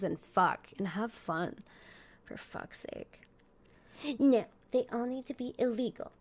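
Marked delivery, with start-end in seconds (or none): 2.2-3.2 轻声耳语的抱怨; whisper. Acted. Whispered aside. 4.2-6.1 prissy critic voice. Pretend. Voicing imagined critic.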